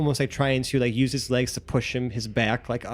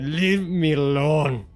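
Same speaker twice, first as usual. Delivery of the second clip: put-on slow drunken slur through gritted teeth; slurred drunk; 3 semitones higher, much slower than usual. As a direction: slur it out like a Scottish-sounding drunk. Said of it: "just slurring all of his words and, like, gritting his teeth".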